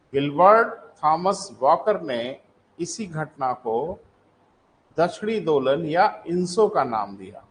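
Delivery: slow, phrase by phrase with pauses; slow dictation. Shown as a demonstration. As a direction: dictate it for students writing it down. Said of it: "लिखें para change करके".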